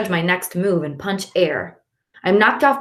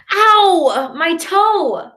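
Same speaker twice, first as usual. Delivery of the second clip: pain; 11 semitones higher, 5 dB louder, much slower than usual. A narrator's reading. After kicking the bookcase.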